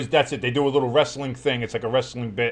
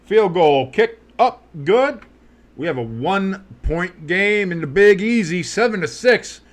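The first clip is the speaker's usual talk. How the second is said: slow, drawn-out broadcast call; announcer call; 6 semitones higher, 6 dB louder, slower than usual. Acted. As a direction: call it like a TV play-by-play. Announcer call over the game.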